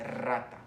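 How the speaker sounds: rata with a long rolled R at the start; "rata", drawn-out multiple trill on the R. Shown as a demonstration. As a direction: roll the R hard in rata. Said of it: "Es una vibrante múltiple".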